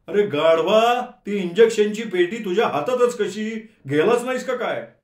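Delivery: a roommate furiously chewing him out; angry scolding. A narrator's reading. Roommate scolding him for not going, loud and angry.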